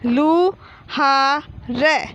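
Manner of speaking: very slow, drawn-out लू, हा, र; teacher stretching each piece of Luhar; syllable by syllable. Shown as a demonstration. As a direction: sound out लू हा र with gaps between. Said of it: "तो ये क्या हो गया?".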